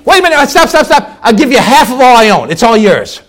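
high, loud, urgent bargaining; hammed-up desperate plea to stop the countdown; frantic pleading. Dying rich man bargains with Death.

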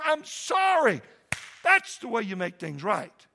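high, loud, hammering pulpit shout; preaching at full force. Acted. Pastor shouting repentance at his congregation, full-force preaching. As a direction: drive it home to the congregation.

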